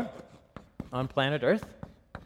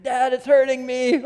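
put-on higher, louder whiny child's plea; mock child's scream; 8 semitones higher, 5 dB louder than usual. A friend's son complaining to his dad in the tent, mock whiny cry. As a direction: whine it like a small boy in a tent. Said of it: "his son starts screaming".

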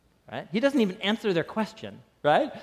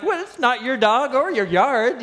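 higher, louder put-upon neighbor voice; mild indignant neighbor; 7 semitones higher, 6 dB louder than usual. Retold or imitated. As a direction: protest like the annoyed neighbor. Neighbor voiced in retold quarrel.

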